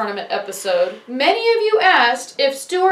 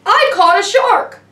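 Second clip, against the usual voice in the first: raised, higher mock yell; louder, higher retold shout; imitated yell; 5 semitones higher, 5 dB louder than usual. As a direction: imitate a bystander calling out excitedly. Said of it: "a girl off to the side yells".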